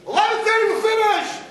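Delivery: high, loud mock-triumphant cheer. Mocking families who rush the Seder, triumphant shout. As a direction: crow it like they won a race.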